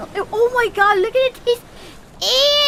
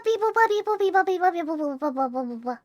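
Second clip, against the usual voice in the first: mock robot beeps sinking lower and softer; 5 semitones lower, 7 dB quieter, faster than usual. Streamer mimics a robot, fading down. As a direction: beep like a winding-down robot.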